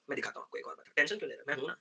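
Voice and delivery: soft, near-whispered promise; teacher quietly reassuring anxious students; hushed reassurance. Real, not acted. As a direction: lean in and calm them.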